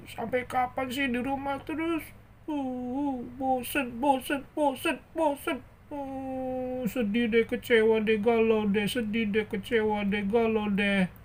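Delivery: high-pitched whining mope; pouty, hammed-up misery; gloomy bored character. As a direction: wail your boredom in a high puppet voice.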